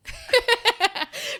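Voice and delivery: high, loud giggling laugh; cracking up at her own line; high burst of laughter. Real, not acted. Telling the host her comeback, real laughter.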